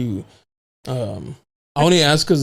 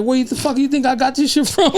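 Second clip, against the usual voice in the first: angry parent; 10 semitones higher than usual. Retold or imitated. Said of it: "It's like".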